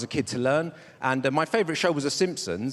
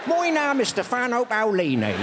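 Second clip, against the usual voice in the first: higher-pitched, broad West Country accent; 5 semitones higher than usual. Shown as a demonstration. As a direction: introduce yourself in a put-on West Country accent. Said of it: "German with a West Country accent".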